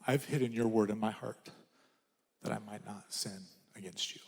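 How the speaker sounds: soft, low, fading to a whisper; reverent whisper. Shown as a demonstration. Preacher giving the right reading of the verse, whispered. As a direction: hush it like a prayer.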